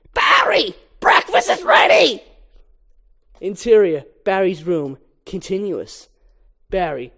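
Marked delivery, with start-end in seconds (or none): 0.1-2.2 high, loud mom calling upstairs; mother yelling. Acted. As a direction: call her son down for breakfast. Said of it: "yells at Barry".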